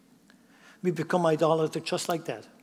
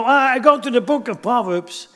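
mock careless reader; 6 semitones higher, 8 dB louder than usual. Mimics a careless reader of Proverbs.

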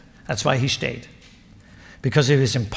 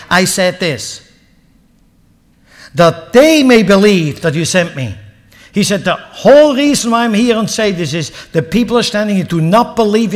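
preaching at full force; 5 semitones higher, 8 dB louder than usual. Acted. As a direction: drive the prayer home to the crowd. Preacher proclaiming Jesus' prayer.

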